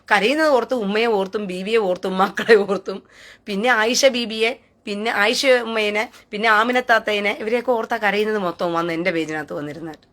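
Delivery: ordinary talk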